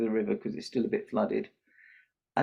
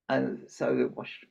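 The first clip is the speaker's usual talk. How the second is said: normal talking voice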